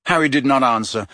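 plain reading voice

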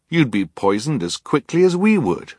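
put-on high-pitched child's retort; defiant. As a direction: throw the threat back at him. Klaus defies Olaf's threat.

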